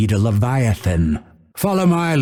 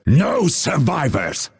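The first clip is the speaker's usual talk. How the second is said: higher-pitched battle order; rousing, raised-pitch war cry; 6 semitones higher than usual. Acted. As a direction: call it out to the fight, pitched up. Battle cry, second take.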